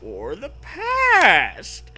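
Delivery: high, slow, loud drawn-out line; dramatic mad scientist. Acted. Mad doctor on TV stretching out the past, slow and loud. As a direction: stretch it like a cartoon villain.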